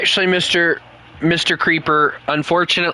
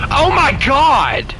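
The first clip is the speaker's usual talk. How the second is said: surprised; 8 semitones higher, 4 dB louder, much slower than usual. Real, not acted. Laughing shock at teammate's joke.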